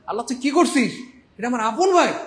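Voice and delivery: high, loud furious scolding; angry scolding. Acted. Angry sibling defending his own brother to a friend. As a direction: scold the friend, punching আপন.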